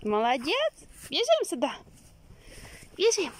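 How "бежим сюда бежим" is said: high, loud, excited call; squeaky excited dog call. Real, not acted. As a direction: urge the dog on, thrilled. Calling the dog to run.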